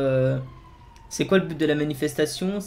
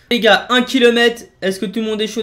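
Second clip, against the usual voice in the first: loud callout; 7 semitones higher, 8 dB louder than usual. Real, not acted. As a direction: stretch it out to fire them up. Hyping squad before mission.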